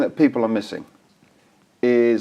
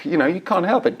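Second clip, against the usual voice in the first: normal talking voice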